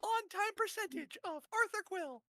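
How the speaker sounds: very high excited squeak; high squeaky voice. Acted. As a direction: speak way up in falsetto. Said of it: "letting [name] introduce himself".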